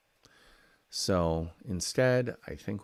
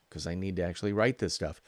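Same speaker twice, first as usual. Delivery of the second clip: ordinary talk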